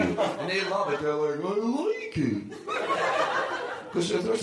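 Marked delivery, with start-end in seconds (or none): 1.4-4.4 very high, stretched-out dog voice; delighted dog voice. Retold or imitated. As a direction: squeal it slowly like a happy dog. Voicing dogs who love it.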